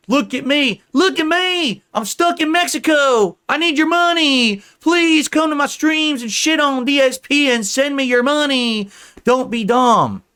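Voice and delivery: high, loud attention-begging; mock desperate plea. Mocking a rival begging viewers for money, desperate plea. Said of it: "This is a desperate attempt".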